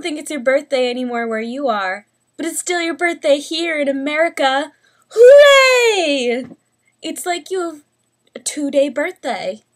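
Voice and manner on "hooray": high loud cheer; excited cheer. Real, not acted. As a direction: shout it out with joy. Cheering at a birthday video.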